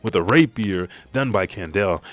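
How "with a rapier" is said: ordinary talk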